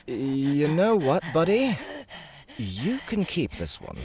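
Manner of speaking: lightly voiced young man, drawn out at first; a hint of a different guy; Nick's voice. A narrator's reading. Nick backing off from a scary princess, lightly voiced. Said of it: "Nick said".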